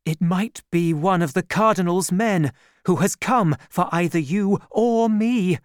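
Milady fearing the cardinal's men, loud and alarmed; frightened cry. A narrator's reading. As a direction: cry out in sudden fear. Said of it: "she cried".

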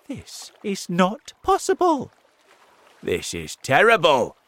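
high, loud shocked cry. A narrator's reading. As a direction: gasp it out. Shock on seeing the sea.

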